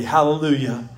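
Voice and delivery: ordinary delivery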